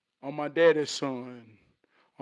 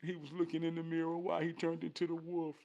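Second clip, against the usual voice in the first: ordinary talk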